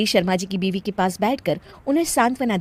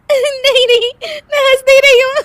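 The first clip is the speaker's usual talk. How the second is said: very high protest dissolving into laughter; denying while laughing; 8 dB louder than usual. Acted. Daughter-in-law to aunts, denying it while laughing. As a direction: insist loudly through helpless giggles. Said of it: "हंस क्यों रही हो?".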